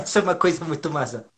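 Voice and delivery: usual speaking voice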